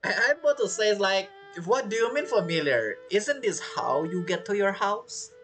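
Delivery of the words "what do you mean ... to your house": ordinary talk, nothing marked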